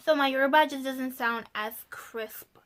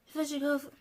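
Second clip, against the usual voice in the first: ordinary talk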